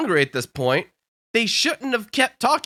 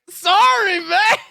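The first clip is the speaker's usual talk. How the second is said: high, louder retold sorry; comic shrugged apology; 11 semitones higher, 5 dB louder, much slower than usual. Customer shrugging at guard while leaving, high comic apology. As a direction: toss it out high and loud.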